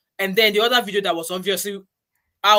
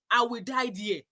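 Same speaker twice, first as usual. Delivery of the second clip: ordinary talk, nothing marked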